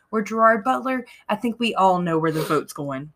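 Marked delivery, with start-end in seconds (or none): none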